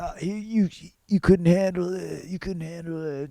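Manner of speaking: high mock-coy taunt, fading to a murmur; teasing taunt. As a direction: tease him in a lightly put-on girly voice. Heroine taunting her lover.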